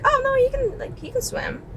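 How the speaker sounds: imitated high little protest trailing into quiet; high-pitched protest. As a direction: pitch it way up, then drop to hushed. Retold reassurance to panicking lifeguard.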